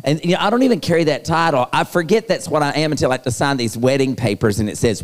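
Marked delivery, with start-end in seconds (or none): none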